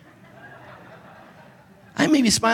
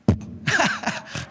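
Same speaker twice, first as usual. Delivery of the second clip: relieved laugh; 8 semitones higher, 4 dB quieter than usual. Retold or imitated. Relief after door shuts.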